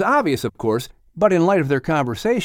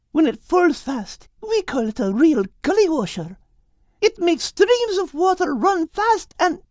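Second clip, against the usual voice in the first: put-on young girl voice. Character line in story.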